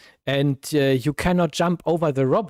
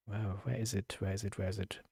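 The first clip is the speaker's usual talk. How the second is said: soft, low searching mutter; muttering under breath; 6 semitones lower, 15 dB quieter, faster than usual. Real, not acted. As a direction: mumble to yourself while looking.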